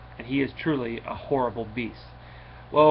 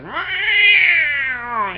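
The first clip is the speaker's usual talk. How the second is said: high loud mock meow; cat meow; 7 dB louder than usual. The cat answering Atrocitus with a loud high meow. Cat character meows in story.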